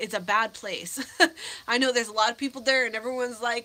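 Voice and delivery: normal talking voice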